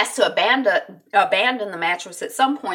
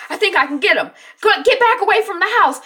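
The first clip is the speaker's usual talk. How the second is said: high, loud acted shout, getting quicker; urgent calling; 9 semitones higher, 6 dB louder, faster than usual. Jack warning family back from house, urgent.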